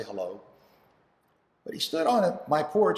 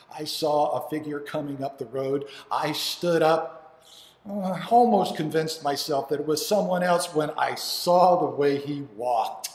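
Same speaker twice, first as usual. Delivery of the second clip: usual speaking voice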